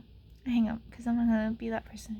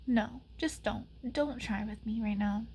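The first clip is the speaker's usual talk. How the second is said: ordinary delivery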